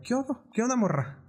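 "¿Qué onda, morra?" rising high as a real question. Retold or imitated. Host imitating a casual greeting, questioning rise. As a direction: ask "¿Qué onda?" with a lifted questioning pitch. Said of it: "En tono de pregunta".

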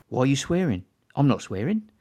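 a hint of a menacing gangster; lightly voiced mock gangster, barely acted; Don Logan impression. Telling how he and his wife quote Don Logan, lightly acted. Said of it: "the Don Logan bit".

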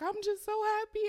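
high-pitched mock weepy voice; hammed-up tearful squeak; imitated happy bawling. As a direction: mimic someone crying from happiness. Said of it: "bawling and, and she was like".

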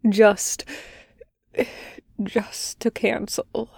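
rasped out, dropping soft; rasping. A narrator's reading. Sick patient cancelling his session, rasped and soft. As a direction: scrape the words out weakly. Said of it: "he rasps".

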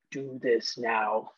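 low, slow, quiet commanding voice; a slow, hushed order from beyond; ghostly command. Retold or imitated. Voicing the dead commanding.

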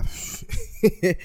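brief, breathy laugh under the breath; small hesitant chuckle before answering; short chuckle. Real, not acted. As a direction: let out a quick little chuckle. Reacting to writer's exit question.

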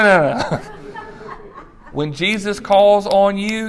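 usual speaking voice

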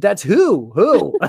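loud, high, puzzled; retold baffled questioning. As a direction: play it up as if baffled by a stranger.

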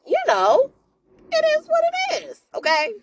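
sudden high-pitched sing-song; high mock-casual shrug; mock Judith. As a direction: toss it off in a high airy voice. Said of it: "Judith was like".

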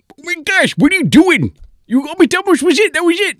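high, loud, frantic mad scientist squeal; cartoon mad scientist in a flap; frantic professor. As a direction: shriek it like a panicked mad professor. Frantic professor grabs the can.

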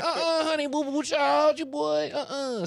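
very high mock-sassy voice; sassy slang girl. Mocking suburban girls faking slang, high sassy impression. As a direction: put on a high-pitched sassy girl act. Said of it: "she know all the slang".

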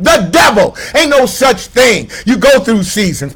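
full-force preaching cry; preaching shout. Real, not acted. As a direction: rise up and shout it from the pulpit.

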